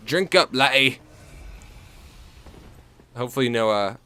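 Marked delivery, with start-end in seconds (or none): 0.1-1.0 mock Scottish accent, louder; a hammed-up Scottish barkeep's toast; lightly put-on Scottish accent. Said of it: "That was supposed to be a Scottish accent".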